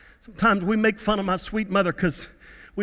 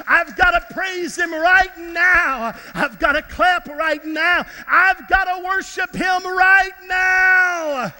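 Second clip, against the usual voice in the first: higher, slow, building to a shout; mock ecstatic praise; 6 semitones higher, slower than usual. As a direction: act out breaking into worship at work. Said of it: "I just need to worship the Lord".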